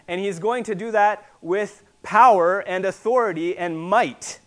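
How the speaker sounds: loud, emphatic pulpit proclamation; forceful preaching. Real, not acted. Preacher proclaiming coming judgment, at full force. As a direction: hammer the key words loudly.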